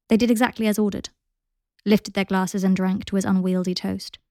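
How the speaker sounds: ordinary delivery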